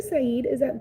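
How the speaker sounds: normal talking voice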